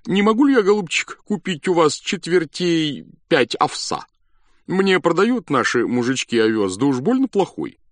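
doctor's request. A narrator's reading. Doctor asks about oats.

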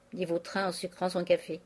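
plain reading voice

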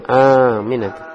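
AMINATU, opening A drawn out; long vowel. Shown as a demonstration. Teacher shows it is only long, stretched first A in "Aminatu". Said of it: "Hanya panjang".

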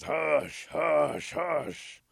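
loud, punched-out repeated order; bossy bullfrog putting a stop to it; commanding bullfrog. Acted. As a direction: croak each word out as a blunt, forceful command. Bullfrog silences the rabbit.

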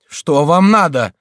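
high, loud, slow frightened question; trembling voice. A narrator's reading. Prior approaching the window to ask, trembling. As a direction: ask it fearfully from above. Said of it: "спросил приор дрожащим голосом".

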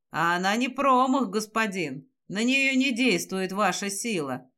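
ordinary delivery

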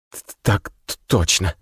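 frightened stuttering youth; stammering boy. A narrator's reading. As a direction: stammer it out nervously. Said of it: "заикаясь".

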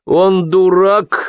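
high, stretched insult; angry drunken outburst. A narrator's reading. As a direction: blurt it out, drunk and fed up. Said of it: "выпалил окончательно обозлённый и пьяный Кытин".

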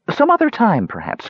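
high, airy call; light high kender call. Acted. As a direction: toss it back lightly. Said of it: "called back Talaran".

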